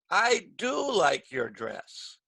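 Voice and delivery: drawn-out, emphatic "do"; stress on DO. Shown as a demonstration. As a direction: lean slowly on "do" to correct a mistake. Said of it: "we just do it with our voice".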